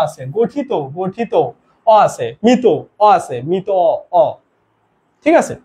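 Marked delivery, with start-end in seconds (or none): none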